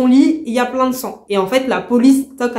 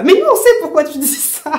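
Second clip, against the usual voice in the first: higher, louder indignant jab; 7 semitones higher, 4 dB louder than usual. Real, not acted. Reader calling out the older man, indignant. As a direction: call him out, outraged. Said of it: "Ça m'énerve".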